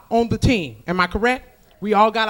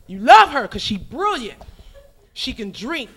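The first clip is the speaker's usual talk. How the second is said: ordinary talk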